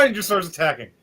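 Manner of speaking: ordinary talk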